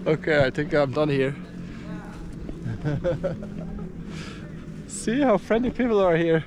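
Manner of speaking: usual speaking voice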